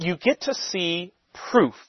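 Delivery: "proof" drawn out slowly after a slight break; stress on proof. Real, not acted. Teacher flags a key word for next week, stress on "proof". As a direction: lean on "proof", giving it a long, weighty beat. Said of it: "I emphasize that word".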